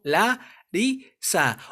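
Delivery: "la ri sa" split into three separate syllables; "la ri sa" divided slowly for a learner. Shown as a demonstration. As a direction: say "la... ri... sa" with a clear break between syllables. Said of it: "se separa como".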